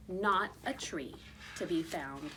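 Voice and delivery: plain reading voice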